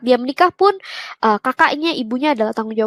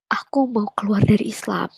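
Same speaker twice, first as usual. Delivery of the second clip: hushed, voiceless whisper to father; whispered confession; 3 semitones lower, 5 dB quieter than usual. Retold or imitated. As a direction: breathe the words out, afraid to say them. Confessing leaving Islam to father. (berbisik pelan mengaku ke papa)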